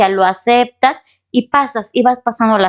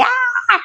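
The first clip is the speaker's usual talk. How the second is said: high, held "ah" of crying out; imitated bawling; 12 semitones higher, 3 dB quieter than usual. Retelling how they let all the pain out, imitated bawling. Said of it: "berré y así de".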